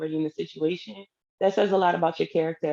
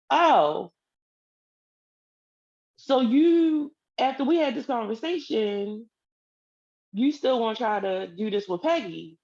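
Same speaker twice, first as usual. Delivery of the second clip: indignant disbelief; 3 semitones higher than usual. Retold or imitated. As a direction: catch him out, outraged at first. Confronting boyfriend about Peggy.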